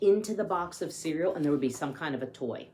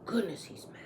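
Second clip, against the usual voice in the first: whisper; 6 semitones higher, 5 dB quieter than usual. Real, not acted. Aside to listening children.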